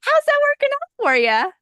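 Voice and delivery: very high, loud mocking question; mock sarcastic adult, very high and loud. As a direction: needle them with a singsong high jab. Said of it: "like, functional adulty".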